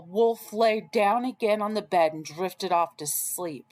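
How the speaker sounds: ordinary delivery, nothing marked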